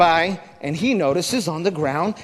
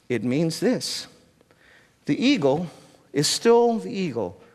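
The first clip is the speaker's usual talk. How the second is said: ordinary talk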